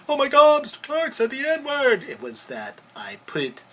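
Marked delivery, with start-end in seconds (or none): 0.1-2.0 mock shocked gasp. Voicing the judges' shock.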